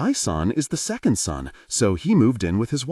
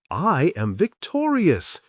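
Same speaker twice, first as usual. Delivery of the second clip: slow, higher, grandly triumphant; mock triumph over a four-year-old; dramatic; 8 semitones higher, slower than usual. A narrator's reading. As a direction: declare victory like a stage hero. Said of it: "she said dramatically".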